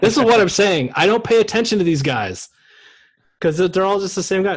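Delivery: worked-up, defensive outburst; exasperated protest. Real, not acted. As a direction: throw your hands up and protest loudly. Exasperated comic protest over mistaken player.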